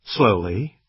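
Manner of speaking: usual speaking voice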